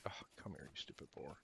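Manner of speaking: hushed whisper at the prey; whispered taunt. Real, not acted. Streamer hunting a boar whispers at it, hushed. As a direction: whisper at the boar so it won't run.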